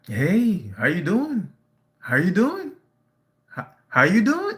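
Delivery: imitating a sarcastic woman's repeated greeting. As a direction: mimic her needling, sarcastic hello. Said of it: "being sarcastic".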